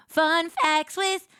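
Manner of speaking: mock show intro; announcer voice. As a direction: announce it like a TV segment. Announcer-style title.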